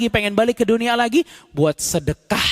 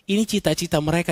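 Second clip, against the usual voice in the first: ordinary talk, nothing marked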